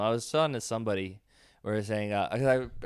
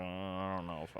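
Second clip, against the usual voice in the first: much softer, lower hesitant mumble; uneasy backing off; 6 semitones lower, 15 dB quieter than usual. Retold or imitated. Comic whose crowd laughs at the wrong part, uneasily mumbling. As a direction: shrink away from your own line in a small voice. Acts comic unsure of joke.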